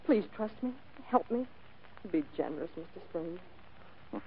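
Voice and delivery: soft, throbbing plea; throb in voice. Acted. Client begging detective to trust her, soft throbbing plea. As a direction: plead softly, voice sinking away at the end. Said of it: "that throb you get into your voice".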